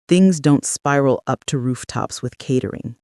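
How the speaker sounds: ordinary delivery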